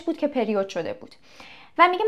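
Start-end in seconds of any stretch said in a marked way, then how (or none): none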